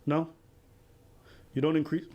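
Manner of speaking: usual speaking voice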